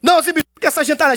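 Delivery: high haughty shout; Dona Florinda's scorn. Retold or imitated. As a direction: fling the word at them.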